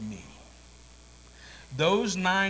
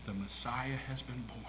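hushed awed whisper; 2 semitones lower, 7 dB quieter than usual. Acted. Shepherd whispering the news in awe. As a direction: breathe it like a secret.

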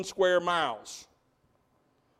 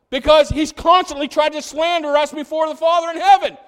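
louder, higher impassioned preaching; fiery pulpit delivery building in fervor; preaching at full force; 6 semitones higher, 10 dB louder than usual. Acted. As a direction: drive it home to the congregation.

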